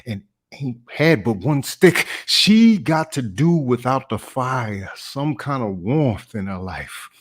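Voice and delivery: slow, heartfelt plea with sudden loud punches; impassioned dramatic monologue. Acted. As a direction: draw the words out, swelling on key words. Audition monologue performed.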